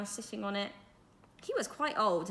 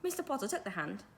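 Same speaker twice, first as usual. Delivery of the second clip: ordinary delivery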